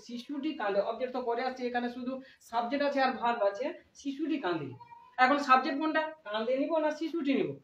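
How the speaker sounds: normal talking voice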